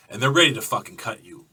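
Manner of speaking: usual speaking voice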